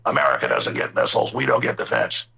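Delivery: a lightly voiced, deep-pitched Trump; imitating Trump. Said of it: "and say".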